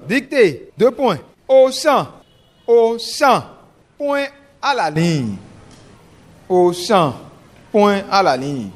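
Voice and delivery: slow dictation with punctuation; dictating. Shown as a demonstration. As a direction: read out slowly for pupils to write. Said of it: "je vais lire une première fois".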